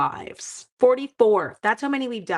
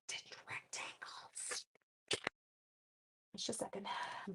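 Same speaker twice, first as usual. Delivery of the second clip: whisper; 21 dB quieter than usual. Real, not acted. Whispered aside while crafting.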